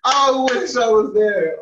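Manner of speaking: loud, breathless from laughter; after laughing hard. Real, not acted. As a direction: still gasping from a laugh.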